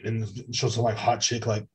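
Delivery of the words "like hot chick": ordinary talk, nothing marked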